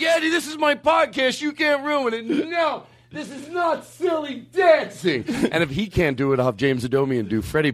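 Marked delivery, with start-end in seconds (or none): 0.0-5.2 much higher, desperate yelling; pleading shout. Retold or imitated. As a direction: yell at him to stop ruining it. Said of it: "I'm like".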